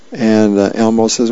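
plain reading voice

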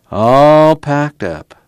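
slow, drawn-out "All packed up"; slow echo. A narrator's reading. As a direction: stretch "All" long and high, then fall. Muley echoes the words slowly.

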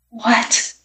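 small, hushed question; fearful whisper. A narrator's reading. Frightened boy asking the magician, whispering. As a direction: whisper it softly, almost afraid to ask. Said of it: "whispered Alan, almost afraid to hear the answer".

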